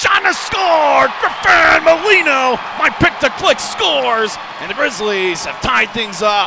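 shouted goal call. Real, not acted.

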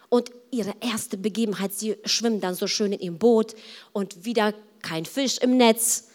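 ordinary talk

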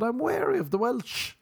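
high-pitched, insistent defence; exaggerated wariness. Arguing back that not all Welsh people are bad, high wary protest. Acted protest in imagined argument.

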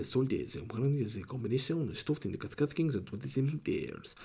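thick put-on Spanish accent with swooping pitch; Spanish voiceover accent. Podcaster selling sausage as voiceover guy, mock Spanish accent. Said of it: "I'm the Spanish voiceover guy".

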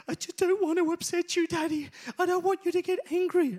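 put-on high-pitched small child's voice; upset little boy. Crying son to daddy at bedtime, high and pleading. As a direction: imitate a tearful child pleading upward. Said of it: "he starts crying".

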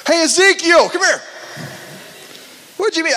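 high, loud friendly call; calling out to Ezekiel. Retold or imitated. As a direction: call Ezekiel over across the room. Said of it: "we're like".

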